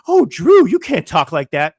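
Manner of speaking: mocking scandalized critic. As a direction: parody the scolds clutching their pearls. Mocking critics scolding him.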